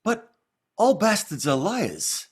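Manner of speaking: Joey Zaza impression. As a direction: drawl the line out slowly, a mild gangster swagger. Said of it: "I just did this little impression".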